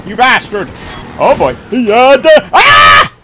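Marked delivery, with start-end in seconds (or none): 1.7-3.1 loud cry rising to a scream; startled yell. Real, not acted. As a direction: yell out and end in a high scream. Startled in a fight.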